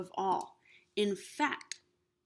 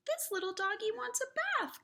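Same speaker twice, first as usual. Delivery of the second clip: put-on much higher, quick little girl; little girl's voice; 13 semitones higher, faster than usual. Little girl tells her family, high and excited. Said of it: "cried the little girl".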